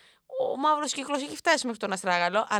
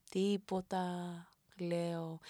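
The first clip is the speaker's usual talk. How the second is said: usual speaking voice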